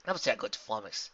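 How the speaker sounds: usual speaking voice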